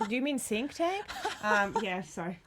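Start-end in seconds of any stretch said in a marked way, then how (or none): none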